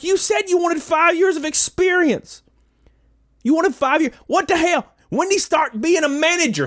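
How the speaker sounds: high, incredulous mock rant at the manager; indignant rant. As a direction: pile on the disbelief, voice up high.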